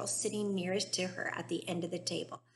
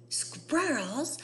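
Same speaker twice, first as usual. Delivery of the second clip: high shouted exclamation; 6 semitones higher, 4 dB louder than usual. Acted. Veruca spotting the squirrels, shouting. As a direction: blurt it out loud. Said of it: "shouted Veruca Salt".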